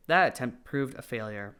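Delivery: usual speaking voice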